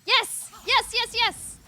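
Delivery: loud high triumphant yes; excited cheering. Real, not acted. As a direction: cheer out in delight. Celebrating a game win.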